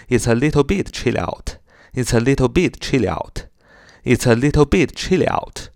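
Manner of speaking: "It's a little bit chilly out" run together as one phrase; linked speech. Shown as a demonstration. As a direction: link "It's a" and "chilly out" without gaps. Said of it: "如果连读起来就是". (把"It's a little bit chilly out"连读)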